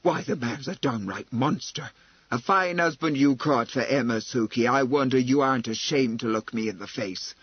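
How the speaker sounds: forceful. Acted. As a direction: lay into them, unable to hold back. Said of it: "said forcefully".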